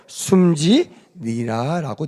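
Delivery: very slow, drawn-out word; slow reading. Shown as a demonstration. Preacher reading how Jesus died, very slow. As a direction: let the word die away slowly. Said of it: "어떻게 했다고요".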